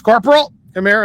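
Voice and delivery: barked out loud and high, calling him over; commander's call. Retold or imitated. Commander summons the corporal, loud sharp call.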